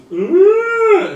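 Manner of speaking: loud high monster roar; snail roar. Acted. Snail roars at the approaching druid, loud and high. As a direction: bellow it out like a startled beast. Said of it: "it goes".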